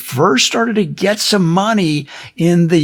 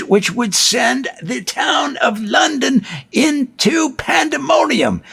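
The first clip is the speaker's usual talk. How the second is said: higher and higher toward pandemonium; rising excitement; 7 semitones higher than usual. A narrator's reading. Telling the crowd's frenzy, voice climbing higher. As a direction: build the excitement to a peak.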